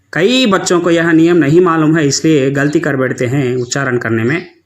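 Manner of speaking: usual speaking voice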